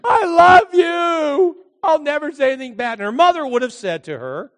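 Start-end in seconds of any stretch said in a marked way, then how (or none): 0.0-3.0 high, drawn-out pleading wail; mock-hysterical apology, pitched way up; groveling daughter. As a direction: beg mother's forgiveness, hammed up.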